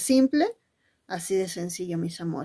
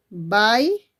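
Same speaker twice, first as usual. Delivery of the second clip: "baile" broken in two, rising "bai", long pause, then "le"; split into syllables; 4 dB louder than usual. Shown as a demonstration. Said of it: "¿Cuántas sílabas?".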